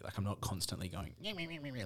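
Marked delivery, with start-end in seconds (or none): 1.2-1.8 high, soft nonsense mimicry; mock your friend with a squeaky babble; mocking friend's voice. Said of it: "like, in your, in your voice".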